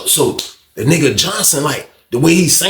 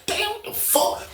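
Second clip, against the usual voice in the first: hushed shock; 11 dB quieter, slower than usual. Retold or imitated. Hearing the fight unseen.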